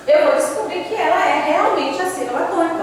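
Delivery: normal talking voice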